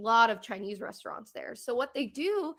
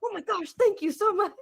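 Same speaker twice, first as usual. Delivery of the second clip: much higher and louder, laughing thanks; thrilled owner; 10 semitones higher, 7 dB louder than usual. Retold or imitated. Owner thanking tourists for reading reviews, thrilled gush. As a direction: gush like the owner can't believe it.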